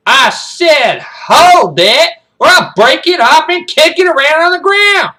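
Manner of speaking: high, full-volume drill-sergeant yell; bellowed, escalating military menace; furious threat. Acted. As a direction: scream the warning at the cadet.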